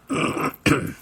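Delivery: clears a hoarse throat; throat clearing. Real, not acted. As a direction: clear your throat before going on. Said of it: "sorry if I'm losing my voice".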